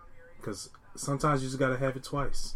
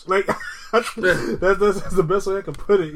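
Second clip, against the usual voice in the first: laughing; 6 semitones higher, 6 dB louder than usual. Real, not acted. Laughing about Nikki's looks.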